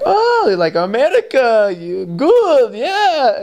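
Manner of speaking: high, hammed-up Russian "America, you good"; mock Russian accent. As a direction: tease like a Russian baller, pitched high. Imitating Russian players.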